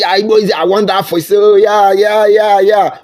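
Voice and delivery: high, gushing imitation; hammed-up cheering chant; mock hype. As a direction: parody a young hype man.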